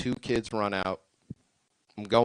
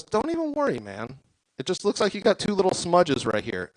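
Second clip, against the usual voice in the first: high chill mechanic; 7 semitones higher than usual. Acted. Mechanic voiced in story.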